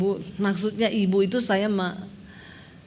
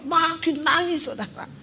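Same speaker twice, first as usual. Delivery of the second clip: normal talking voice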